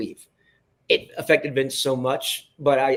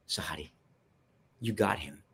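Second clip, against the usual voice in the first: low, soft, confiding; hushed retold verdict; 7 semitones lower, 9 dB quieter than usual. [name]'s role turning to the director after the boss leaves, hushed. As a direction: drop low and soft, imitating a colleague's aside.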